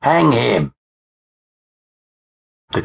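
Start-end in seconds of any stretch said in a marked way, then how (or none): 0.0-0.7 raised, higher cry from the crowd; narrator's contained mob shout. A narrator's reading. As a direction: call out for blood, pitched up. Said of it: "I heard a voice shout".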